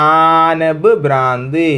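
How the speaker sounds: ആനബ് ഭ്രാന്ത് drawn out slowly, each sound clear; slow model pronunciation. Shown as a demonstration. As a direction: slowly spell out ആനബ് ഭ്രാന്ത് for students to copy. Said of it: "അങ്ങനെ pronounce ചെയ്തോ".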